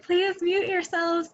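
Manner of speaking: high, slow singsong. Retold or imitated. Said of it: "I was like".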